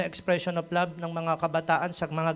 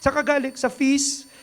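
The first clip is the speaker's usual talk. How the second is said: put-on parent asks, child answers; 9 semitones higher, 5 dB louder than usual.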